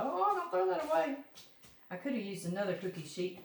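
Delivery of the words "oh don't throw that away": tag only: imitating husband. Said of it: "so he's like".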